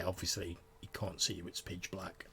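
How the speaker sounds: ordinary talk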